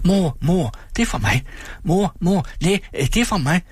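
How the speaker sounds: put-on high, excited child voices; eager children. A narrator's reading. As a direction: push your gift forward. Children offering gifts. (ivrige børnestemmer)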